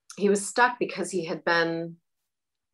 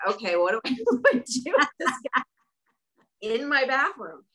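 high, baffled mock plea; asking her guide on the toilet, mock exasperated; 6 semitones higher than usual.

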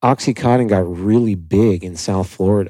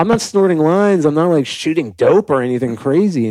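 defensive protest; 6 semitones higher than usual. Retold or imitated. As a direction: brush off mom's worry. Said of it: "I go".

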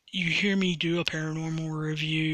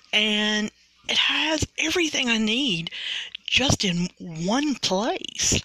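upbeat, higher-pitched sponsor plug; podcast ad read; 5 semitones higher, 3 dB louder than usual. Acted. Host pitches Anchor to would-be podcasters, peppy ad read. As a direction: sell it with lively, swooping pitch and punchy emphasis.

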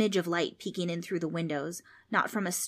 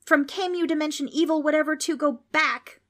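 high, loud banishing shout; 9 semitones higher, 5 dB louder than usual. A narrator's reading. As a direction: order it back with a raised voice. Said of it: "Frank shouted at the ghost".

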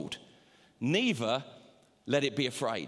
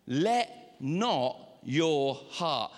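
ordinary talk, nothing marked

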